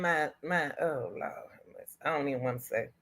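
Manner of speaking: low, fading whisper of Lord have mercy; overcome, trailing into a whisper; hushed stricken murmur; whispered dismay. Real, not acted. Overcome by the news.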